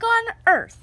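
jumps up high on Earth; high lift. A narrator's reading. Narrator stresses the important job with a high jump. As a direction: pop up on the last words.

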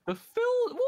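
surprised. Real, not acted. Baffled by the Philza joke.

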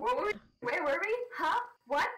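high, rising, bewildered questions; hammed-up dazed confusion; mock disorientation. Plays disoriented at stream start.

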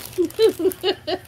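high short burst of laughter; chuckling at a friend's note; amused laugh. Real, not acted. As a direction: laugh at the little joke. Laughing about [name].

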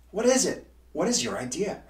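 can't wait to hear the idea; eager question. A narrator's reading. Miles eagerly asking Hugo about the idea. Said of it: "asked Miles".

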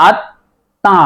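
আত্মা slowly split into at and tã; slow at-tã, m silent, for আত্মা; doubled consonant. Shown as a demonstration. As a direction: pause inside the double t of আত্মা. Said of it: "দ্বিত্ব হয়েছে".

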